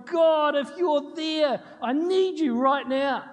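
high, slow, loud cry to God; desperate plea. Retold or imitated. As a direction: plead it out, thumping the wheel. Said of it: "I was just going".

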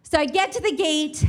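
usual speaking voice

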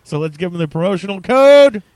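toy commercial. Pretend. Mock commercial pitch.

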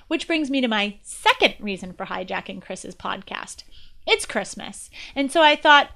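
normal talking voice

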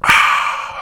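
breathy satisfied exhale; refreshed ah. Retold or imitated. Drinker after a big swig, satisfied breathy ah. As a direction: let out a refreshed ahh.

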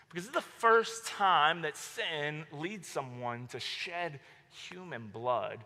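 ordinary talk

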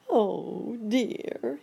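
high, storybook "oh dear" lament; high-pitched, acted distress; dismayed cry. A narrator's reading. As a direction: cry out as the stuck monkey. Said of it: "he cried".

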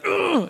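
high, falling exasperated groan; exasperated ugh. Retold or imitated. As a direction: groan like a worn-out parent. Imitated noise about son's negotiating.

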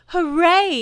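loud high cheer; shout. A narrator's reading. Child cheering Pooh floating up on the balloon. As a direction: shout it up in delight. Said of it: "you shouted".

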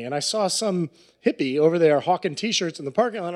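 ordinary talk, nothing marked

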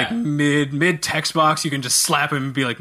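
ordinary talk

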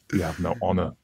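lightly voiced samurai, held back; the character voice. Shown as a demonstration. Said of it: "I don't have to do The Voice".

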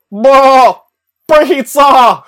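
loud, high, silly goat-man bark; goat man Billy. Retold or imitated. Draenei roleplayer answering a pompous night elf, loud and high. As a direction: blurt it out like a dim goat creature. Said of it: "I'm gonna roleplay as Billy".

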